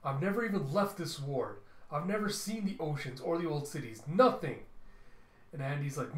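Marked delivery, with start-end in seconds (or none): 0.0-4.6 acted angry daughter, raised voice; angry outburst. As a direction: protest hotly, ending on a loud high punch. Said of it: "Mila just gets up angrily".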